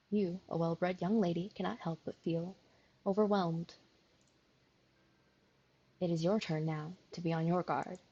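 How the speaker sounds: calm, measured storyteller read; story narration. A narrator's reading. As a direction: narrate the scene evenly.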